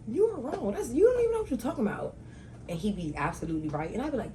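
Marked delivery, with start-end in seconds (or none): 0.1-2.2 high-pitched, incredulous retort; mock contradicting. Daughter telling her father he's wrong, high mock protest. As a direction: protest in a much higher voice.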